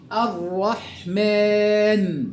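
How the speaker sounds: الرحمن very slowly, rolled double r and long aa; slow recitation. Shown as a demonstration. Teacher drills the sun lam, slow recitation. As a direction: roll the ر in الرحمن, stretch the ending. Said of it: "كيف نقرأ هكذا".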